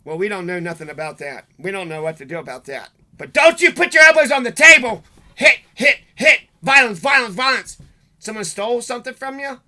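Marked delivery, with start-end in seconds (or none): 3.2-9.6 much higher and louder, hammed-up scolding; mock ranting parent. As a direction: shriek the rule like a mocked strict parent. Mocking parents' rule.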